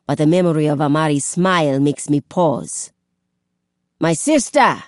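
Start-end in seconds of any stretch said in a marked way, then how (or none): 4.0-4.8 shouting over roaring water; calling out. A narrator's reading. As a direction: call loudly across rushing water. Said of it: "I call above the roaring water".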